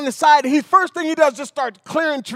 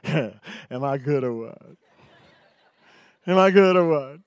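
mock brag; 9 semitones lower than usual. Dad bragging.

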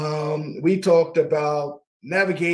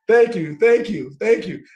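thanking through a laugh, a bit higher; laughing thanks; 5 semitones higher, 6 dB louder than usual. Real, not acted. Host laughing thanks to his producer for the praise. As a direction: laugh it out, grateful.

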